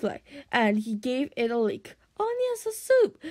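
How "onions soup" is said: high-pitched little dragon, anxious. A narrator's reading. Toothless smells soup on Hiccup, high anxious squeal. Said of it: "said Toothless anxiously".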